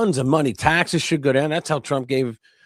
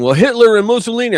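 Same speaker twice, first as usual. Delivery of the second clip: higher, louder, fast mocking retort; hammed-up smug liberal comeback; mocking opponent; 10 semitones higher, 6 dB louder, faster than usual. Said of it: "they're saying".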